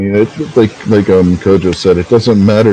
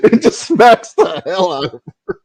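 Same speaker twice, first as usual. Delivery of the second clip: high-pitched, laughing through the words; real laughter spilling into speech; 9 semitones higher than usual. Real, not acted. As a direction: finish the story while cracking up. Laughing while retelling the cat slap.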